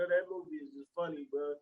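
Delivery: ordinary talk, nothing marked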